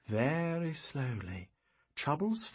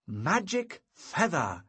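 "magic feather" drawn out slowly, higher, falling at the end; dramatic proclamation; 5 semitones higher, 3 dB louder, slower than usual. A narrator's reading. Wizard reveals "magic feather" to the boy, dramatic proclamation. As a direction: announce "magic feather" as a grand, slow reveal. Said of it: "With dramatic tones, I proclaimed".

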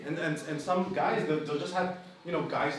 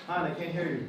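ordinary talk

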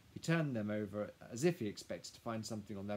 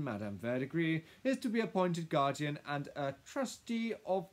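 loud, raised-pitch lawyer reading a will; formal lawyer; 6 semitones higher, 8 dB louder than usual. Acted. Lawyer reading terms to Lily's household, formal. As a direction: announce the legal terms officiously.

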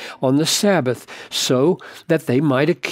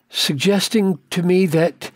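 ordinary talk

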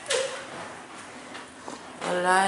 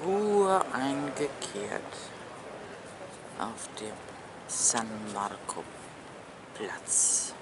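langsam, tiefer und viel leiser; feierlich leise; 10 semitones lower, 15 dB quieter, much slower than usual. Acted. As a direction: wie ein leiser Dokumentarsprecher sagen. Feierliche Ansage, leise, nachts. (leise feierliche Ansage, zum Schluss geflüstert)

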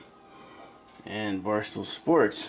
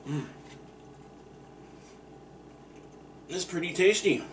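talking while chewing pizza; muffled by food in mouth; mouth full, chewing; 6 semitones higher, 8 dB quieter than usual. Real, not acted. Seen 1: Bites slice at start, chews with closed mouth through labelled words.

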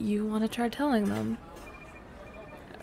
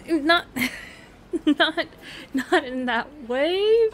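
flustered laughing; 6 semitones higher, 5 dB louder than usual. Real, not acted.

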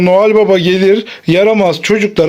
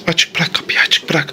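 hushed whisper, barely voiced; 5 semitones lower, 6 dB quieter than usual. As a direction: whisper so Santa won't hear. Hiding, waiting for Santa.

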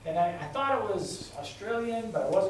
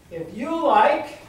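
sarcastic; 6 semitones higher, 6 dB louder, much slower than usual. Acted. Sarcastic campaign taunt.

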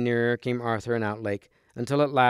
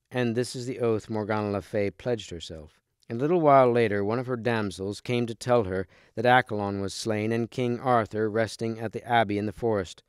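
ordinary delivery

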